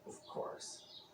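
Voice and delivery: soft secret whisper; hushed whispered aside to close the tale. Acted. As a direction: breathe it as a secret. Sly whispered story ending.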